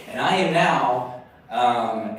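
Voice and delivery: ordinary delivery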